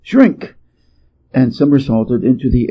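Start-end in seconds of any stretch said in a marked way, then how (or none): 0.0-0.5 high cry of Shrink; a high cry, leaping into the air; cried out. A narrator's reading. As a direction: shout the spell word way up. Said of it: "Monkey cried".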